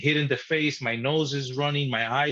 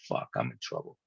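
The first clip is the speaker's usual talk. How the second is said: much lower and softer, a scared inner curse; hushed dread; 9 dB quieter than usual. Retold or imitated. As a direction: say it low under the breath like a frightened kid. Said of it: "I would have said".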